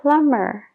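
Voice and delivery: "plumber" said as plummer; no b sound in "plumber"; silent b in plumber. Shown as a demonstration. Teacher models "plumber" with a silent b. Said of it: "esa B es como si no existiera".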